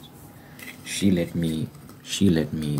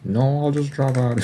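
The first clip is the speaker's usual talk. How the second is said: high, louder, mock carefree; breezy decision; 9 semitones higher, 4 dB louder than usual. Retelling deciding to drop out, mock carefree. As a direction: toss it off like it's nothing. Retold sudden decision to quit.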